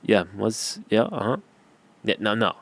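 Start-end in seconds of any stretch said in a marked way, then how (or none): 0.0-1.4 mumbled, lazy, barely-there delivery; low-energy mumbling. Shown as a demonstration. Demoing for viewers how not to talk to camera, half-hearted mumble. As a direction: shrug it off like you can't be bothered. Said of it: "just be like".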